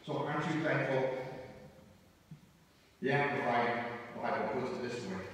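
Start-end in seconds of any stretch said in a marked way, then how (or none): none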